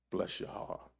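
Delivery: soft breathy whisper of comfort; whispered blessing. Pretend. Jesus comforting the returning sinner, whispered. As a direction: whisper it like a tender mercy.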